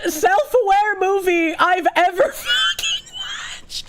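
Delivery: words broken up by laughter, last word squeaked high; laughing through words. Real, not acted. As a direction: try to finish the sentence while cracking up. Laughing through her verdict.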